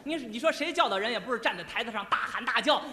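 ordinary delivery

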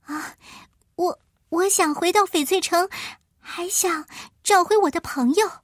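put-on high, hesitant girl voice; little girl voice. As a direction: stammer out a hopeful wish. Dorothy makes her wish.

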